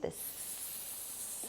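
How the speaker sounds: hissing "s-" with no voice; drawn-out s like a snake. Shown as a demonstration. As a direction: hiss the "s-" like a snake. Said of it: "like a snake".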